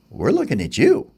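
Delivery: a hint of a dry sparrow. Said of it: "and they go".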